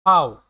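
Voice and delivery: ফাও said loud and clipped, as the German letter name; letter name demo. Shown as a demonstration. Teacher names the German letter V aloud for students. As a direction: punch out ফাও as a single crisp syllable. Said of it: "ভির উচ্চারণটা হচ্ছে ফাও".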